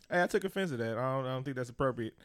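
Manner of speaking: lightly voiced, soft, polite complaint. Shown as a demonstration. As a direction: keep it mild and proper, no blow-up.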